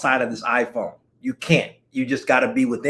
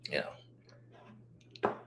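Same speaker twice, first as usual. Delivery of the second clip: breathy whispered trail-off; whispered you know to close the thought; whispered aside; 22 dB quieter than usual. Real, not acted. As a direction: let it fade into a whisper.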